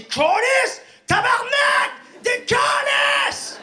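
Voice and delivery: very high, loud slow swearing; angry driver swearing at the wrong-way car; furious driver. Acted. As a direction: hurl the curses like an enraged driver.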